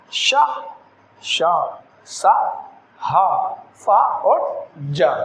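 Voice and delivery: श, सा, हा hissed out very slowly; fricative demo. Shown as a demonstration. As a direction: let the air hiss through श and सा. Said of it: "जैसे". (घर्षण के साथ धीमा उच्चारण)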